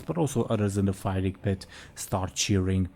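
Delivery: ordinary delivery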